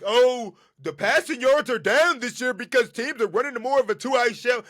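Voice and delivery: high, loud mock of the narrative; mocking quote. Host mimics a narrative he hates, mocking. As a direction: parrot the complaint up high and loud. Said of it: "I hate that narrative that".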